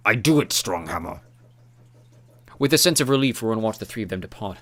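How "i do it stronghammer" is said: Urgal voice. A narrator's reading. Urgal voice, slow, different timbre.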